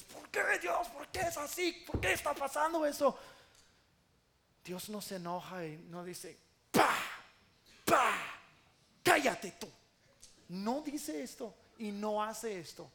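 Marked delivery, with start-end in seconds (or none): 0.0-3.2 high, anguished shout of protest; crying out to God. Retold or imitated. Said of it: "yo le grito a Dios". 6.7-9.7 loud mock slaps and a scolding; angry rebuke. Acts God angrily slapping, silencing.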